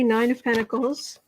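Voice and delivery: ordinary talk, nothing marked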